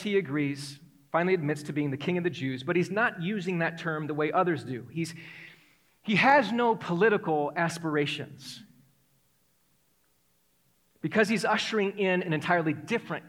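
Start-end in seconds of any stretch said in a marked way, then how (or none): none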